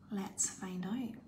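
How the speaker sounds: slow breathy suspense whisper. Real, not acted. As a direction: whisper to build tension. Suspense before draw.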